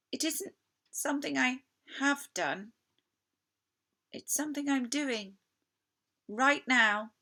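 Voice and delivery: guilty fawn pouring out his shame to Lucy; anguished fawn. Acted. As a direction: beg her to see how wicked you are. Tumnus insisting it's happening now.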